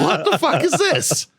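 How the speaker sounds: very high, drawn-out mock outrage; incredulous wail. Veteran replaying his reaction to the charges, incredulous wail. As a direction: stretch it out high in comic disbelief. Retold shock at warrant news.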